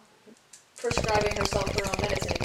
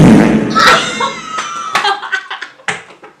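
loud screaming laughter; shrieking laugh; 10 semitones higher, 12 dB louder than usual. Real, not acted.

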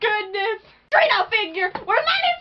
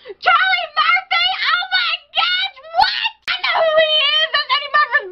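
excited shout; 7 semitones higher than usual. Real, not acted. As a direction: shout with recognition. Recognizing voice actor.

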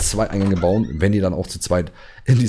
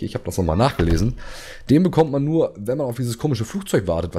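usual speaking voice

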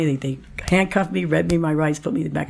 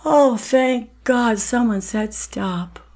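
slow, high, relieved sigh of a line; relieved inner voice; 8 semitones higher, much slower than usual. Retold or imitated. Her inner self relieved that someone stopped her, slow and high. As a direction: let the relief pour out slowly. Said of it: "the inside of me went".

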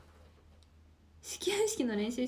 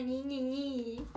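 drawn-out number; much slower than usual. Shown as a demonstration. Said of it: "ゾロ目、ゾロ目、".

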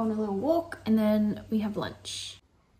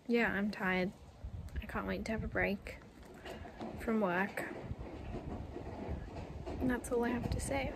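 ordinary talk

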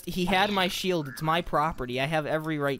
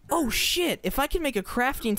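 sudden high yelp of alarm; startled outburst; 10 semitones higher than usual. Real, not acted. Gamer remembering danger, startled high yelp. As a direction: blurt it out in shock. Sudden realization while gaming.

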